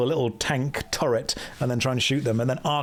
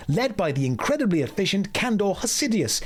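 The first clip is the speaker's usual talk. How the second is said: higher, proud storytelling shopkeeper; higher, hammed-up NPC merchant voice; Kadikarus impression; 5 semitones higher than usual. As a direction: boast about the city like a cheerful merchant. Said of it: "be doing a Kadikarus impression".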